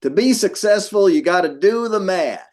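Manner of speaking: high, loud Southern twang; hammed-up Southern accent impression. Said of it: "he's got this great Southern accent".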